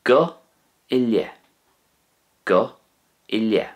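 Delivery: every word of go i le sounded out apart; full form of go i le. Shown as a demonstration. Teacher shows learners the Connacht phrase, go i le in full. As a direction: go i le said in separate words. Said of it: "that's very often shortened down to".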